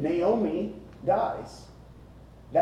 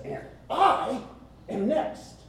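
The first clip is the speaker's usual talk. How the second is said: high-pitched acted declaration with a big "I"; eager, emphatic claim; 11 semitones higher, slower than usual. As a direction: throw "I" up high, then land "am next". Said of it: "this emphasis".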